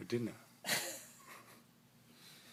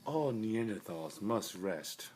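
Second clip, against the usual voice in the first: slow dramatic nature narration; documentary narrator; 2 semitones higher than usual. Acted. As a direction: narrate like a wildlife film.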